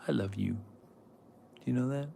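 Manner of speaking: soft, slow loving murmur; tender to the dog. Real, not acted. Owner telling her dog she loves her, soft and slow. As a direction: coo it gently to your pet.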